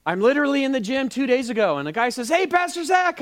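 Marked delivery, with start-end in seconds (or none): 2.3-3.2 high, hearty retold greeting; friendly greeter. Guy at the gym greeting his pastor, high and friendly. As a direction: call it out pitched well up.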